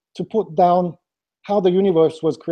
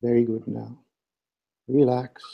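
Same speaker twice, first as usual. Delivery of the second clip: right in your ear; 6 semitones lower, 4 dB quieter than usual. Acted. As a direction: guide them inward, hushed and close. Leads guided meditation with breathing.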